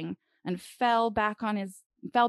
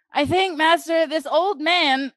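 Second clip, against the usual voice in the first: loud and strained, heaving under a heavy weight; straining grunt; 8 semitones higher, 9 dB louder than usual. A narrator's reading. Thorgir straining to hold the mast, loud strained grunt. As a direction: push the words out through the effort. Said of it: "grunted Thorgir".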